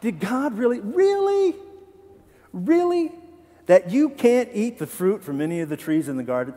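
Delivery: the serpent mocking God's command, sarcastic. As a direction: drag out each really with scorn.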